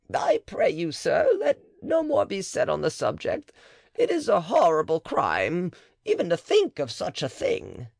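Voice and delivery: high, horrified refusal; high-pitched scandalized midwife; shocked matron. Acted. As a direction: recoil from the very idea. Matron voiced in the dialogue.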